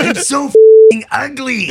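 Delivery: loud, high, slow cartoon chihuahua; drawn-out cartoon character outburst; Ren. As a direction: wail it as an overwrought cartoon dog. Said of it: "You should use the Ren voice".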